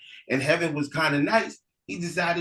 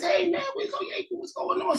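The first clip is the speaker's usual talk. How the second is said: much higher puzzled calling; puzzled elder brother. Acted. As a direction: look round an empty heaven and call.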